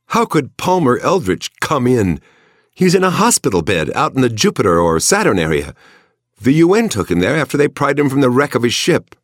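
high, scornful female voice. A narrator's reading. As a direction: scoff at him with curiosity. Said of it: "Her tone was scornful".

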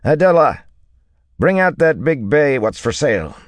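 high, loud call across a stable; shouted. A narrator's reading. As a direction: holler an order to someone at the back. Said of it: "he shouted".

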